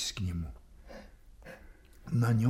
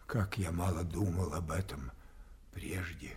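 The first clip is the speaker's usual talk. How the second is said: sinking into a whisper; hushed whisper; 5 semitones lower, 9 dB quieter than usual. Acted. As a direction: let it fade to a breath. Lear's quiet self-reproach.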